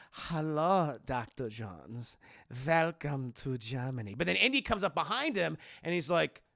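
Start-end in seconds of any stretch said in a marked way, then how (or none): 0.1-4.2 low, slow, menacing welcome; sinister villain greeting. Retold or imitated. Villain greeting Doctor Jones on the blimp, low and menacing. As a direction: purr it like a smug villain.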